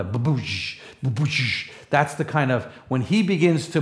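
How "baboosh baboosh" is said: low booming footstep sounds; low thudding baboosh; imitated thunder steps. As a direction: boom out each heavy step. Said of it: "you hear steps of thunder".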